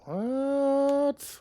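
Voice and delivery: a very high, drawn-out squeak; shocked squeak. Real, not acted. Streamer stunned by the game, very high drawn-out squeak. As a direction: squeal it in disbelief.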